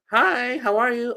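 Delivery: very high, drawn-out chirpy greeting; friendly lady. Retold or imitated. Canadian lady greeting a stranger, friendly.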